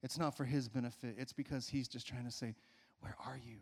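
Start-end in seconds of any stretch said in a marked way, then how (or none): 3.0-3.6 tender call. Acted. God's question voiced tenderly.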